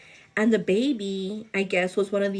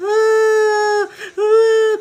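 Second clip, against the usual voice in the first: high, loud wailing baby cry, mimicked; imitated baby crying; 12 semitones higher, 8 dB louder than usual. Retelling the baby's crying, loud high mimicked wail.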